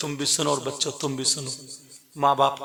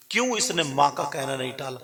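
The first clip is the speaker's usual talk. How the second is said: ordinary delivery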